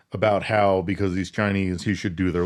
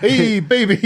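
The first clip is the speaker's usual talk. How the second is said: put-on baby talk; 12 semitones higher, 5 dB louder than usual. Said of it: "a lot of baby talk".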